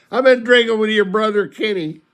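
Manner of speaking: high, loud mock-weepy confession; put-on tearful wail; imitated bawling. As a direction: bawl it out like an upset drunk. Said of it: "And he's bawling".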